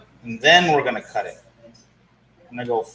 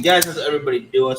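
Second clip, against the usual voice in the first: usual speaking voice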